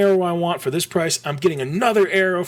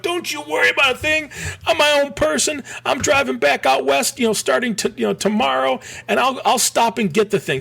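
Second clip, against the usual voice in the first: cocky self; 10 semitones higher than usual. Retold or imitated. Son brushing off his worried dad, much higher cocky mock voice. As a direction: play your younger self brushing dad off.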